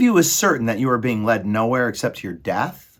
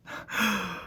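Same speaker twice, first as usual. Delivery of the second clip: high, soft sucked-in breath; sharp gasp; 12 semitones higher, 8 dB quieter than usual. A narrator's reading. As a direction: gasp before the rats' cry. Rats sniff before smelling tears.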